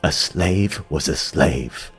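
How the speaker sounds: ordinary delivery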